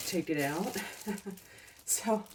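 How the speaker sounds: normal talking voice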